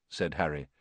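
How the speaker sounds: plain reading voice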